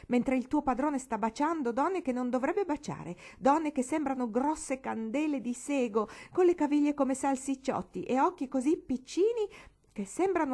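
high, loud outraged rant; indignant girl. A narrator's reading. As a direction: pour out her indignation. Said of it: "così indignata per sua madre". (sfogo indignato, acuto e forte)

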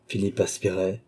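ordinary delivery, nothing marked

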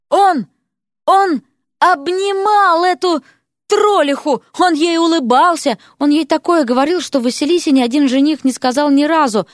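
jealous outburst. A narrator's reading. Vasilisa fuming at what Erik told the troll woman, jealous outburst. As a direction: burst out in hurt outrage.

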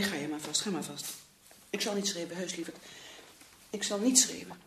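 very soft, subdued promise; hushed and conciliatory; quiet reassurance. Acted. Calming down after quarrel, promises not to shout.

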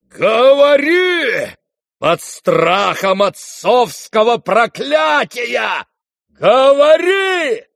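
long, high, bellowed command; drawn-out furious bellow; frenzied roar. Acted. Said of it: "ревел генерал в совершенном исступлении".

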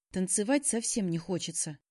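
plain reading voice

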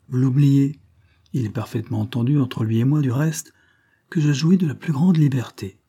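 ordinary delivery, nothing marked

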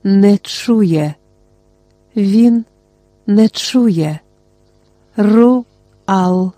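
a hint of a small fragile doll voice, pleading; lightly voiced porcelain doll, calling a name desperately; faint doll-like voice, on the edge of tears. A narrator's reading. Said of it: "знову голос порцелянової ляльки".

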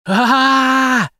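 loud high scream of terror. A narrator's reading. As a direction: scream out of the nightmare. Scream waking from nightmare.

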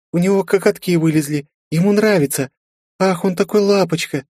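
put-on high, doting girl's voice; cooing young girl. A narrator's reading. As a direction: gush over something cute. Girl cooing over troll.

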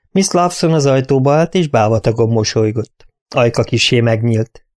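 ordinary talk, nothing marked

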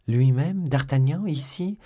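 high, loud, rising disbelief; astonished question. A narrator's reading. Milady learns d'Artagnan is coming, astonished. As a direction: echo the news in astonishment, climbing in pitch.

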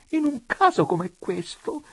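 high-pitched plaintive moan; whining, high lament. A narrator's reading. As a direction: moan it in distress. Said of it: "gemette donna Adelaide".

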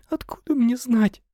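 high and loud, tearful; crying. A narrator's reading. Secretary questioned by her boss, crying. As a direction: cry it out helplessly. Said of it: "снова заплакала Лена".

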